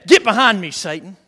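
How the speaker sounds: loud retold command, dropping at the end; strong response. Preacher voices Jesus answering Peter, strong retort. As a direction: order the tempter back firmly. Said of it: "you remember Jesus' strong response?".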